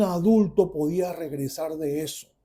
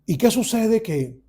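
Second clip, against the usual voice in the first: ordinary delivery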